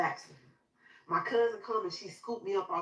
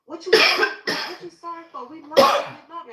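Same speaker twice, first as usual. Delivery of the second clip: high, urgent comforting; retold emotional pleading; emotional family reassurance; 9 semitones higher, 12 dB louder than usual. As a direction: insist on love with a high, distraught voice. Family reassures her in hallway.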